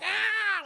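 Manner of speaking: very high, loud mock scream; girls' shriek. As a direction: shriek in fright.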